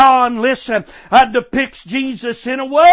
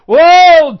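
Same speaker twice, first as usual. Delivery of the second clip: loud exclamation; 5 semitones higher, 7 dB louder than usual. Real, not acted. Preacher exclaims to congregation.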